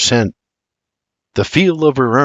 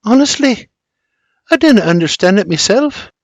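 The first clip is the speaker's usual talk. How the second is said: sighing Scottish; 9 semitones higher than usual. Acted. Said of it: "and sighs".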